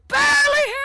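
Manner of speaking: sudden deafened shout. Acted. Diego realizing he's deafened, shouting suddenly. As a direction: yell it without hearing yourself. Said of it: "he hears his own voice".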